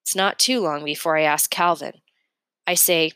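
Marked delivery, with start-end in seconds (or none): none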